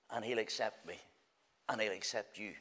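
low, soft and gentle; tender reassurance. Acted. Preacher assuring the listening sinner of acceptance, low and soft. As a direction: reassure them quietly.

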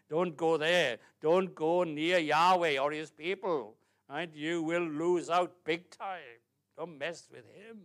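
loud, drawn-out pulpit warning; forceful and slow, every word weighted; emphatic warning. Acted. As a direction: warn them off at full volume. Voices a warning against Yahweh.

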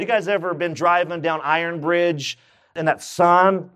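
ordinary delivery

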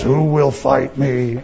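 a slow, hint-of-menace challenge from the pickle giant; Goliath's voice. Retold or imitated. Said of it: "and says".

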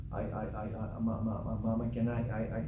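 soft imitation of a stammering grandchild; low and quiet, repeating words while trying to ask; Ethan's stutter. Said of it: "But he talks like this".

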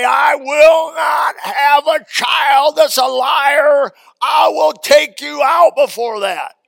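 slow, loud, high furious mother's shout; stern mother. Retold or imitated. Mother threatening her lying child, slow furious shout. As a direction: thunder it like a mother who won't stand a liar. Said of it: "My mother used to say".

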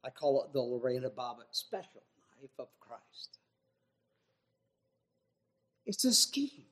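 ordinary talk

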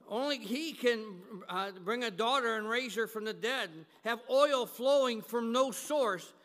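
loud, ringing sermon climax, slowing on the big lines; preaching at full force. Acted. Preacher to his congregation, proclaiming miracles at full force. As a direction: thunder it out to the congregation. Preacher builds to God's wonders.

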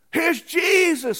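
loud, high, drawn-out mocking announcement; sarcastic. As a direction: stretch it out in loud mockery.

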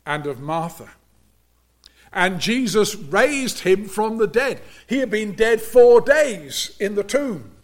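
measured pulpit preaching, rising on key words. Acted. Preacher warning the congregation about unbelief, earnest sermon delivery.

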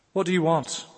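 sudden loud demanding question; loud challenge. Real, not acted. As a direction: fire the question at the congregation.

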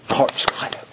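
hushed whisper full of awe; whispered aside. Real, not acted. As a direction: breathe it like a secret. Tender aside on praise.

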